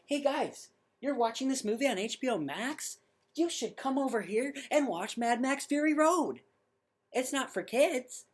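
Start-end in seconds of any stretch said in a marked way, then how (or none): 0.1-6.4 a lightly voiced cheesy streaming ad, higher; hammed-up salesman hyping the service; mock ad pitch. The movie pitching HBO Max to viewers, mock ad voice. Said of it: "it's basically should go".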